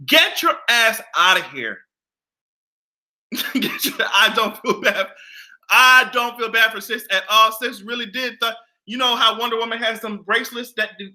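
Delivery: ordinary delivery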